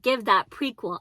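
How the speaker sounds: ordinary talk, nothing marked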